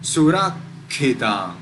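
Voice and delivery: Surakhitam slow, stopped after surak before khitam; pause on double consonant. Shown as a demonstration. Teacher giving an example, Surakhitam with a doubled k. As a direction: hold the k in Surakhitam. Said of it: "It is surakhitam".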